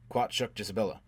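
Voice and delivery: ordinary delivery, nothing marked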